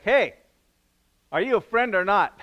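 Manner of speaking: hammed-up Joshua calling out to the armed stranger; mock challenge. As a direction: challenge the stranger like a wary soldier.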